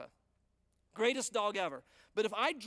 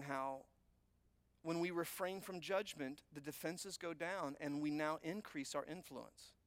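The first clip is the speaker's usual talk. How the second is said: drops to a quiet, gentle sermon voice; soft intimate preaching; 3 semitones lower, 6 dB quieter than usual. Real, not acted. Preacher quietly reassuring his congregation, soft and tender. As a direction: draw them in softly.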